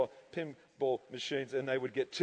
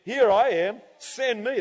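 loud, raised declaration; ringing reply from the pulpit; acted eager answer; 5 semitones higher, 6 dB louder than usual. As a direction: call out as if volunteering. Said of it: "And I said".

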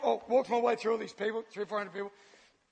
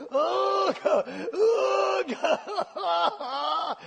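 high, drawn-out wailing sobs; mock deep crying; 6 semitones higher, much slower than usual. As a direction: wail as if it wells up from the feet. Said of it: "It was so deep".

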